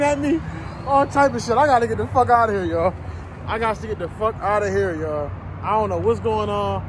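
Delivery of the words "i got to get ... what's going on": ordinary talk, nothing marked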